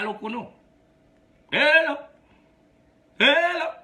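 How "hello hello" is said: normal talking voice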